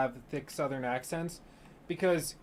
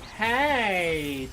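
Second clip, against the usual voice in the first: put-on slow, stretched Valley Girl accent, parodying how Brits hear Americans; mock Valley Girl accent; 3 semitones higher, much slower than usual. Imitating how Brits think Americans talk, in a drawn-out Valley Girl accent. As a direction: drag out the Valley Girl vowels teasingly. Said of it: "they think everybody talks like".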